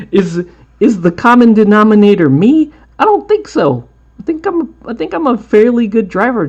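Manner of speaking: high, loud, amused self-defence; mock-indignant. Real, not acted. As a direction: play innocent, with a big jump up in pitch. Laughing, denying he's the cause.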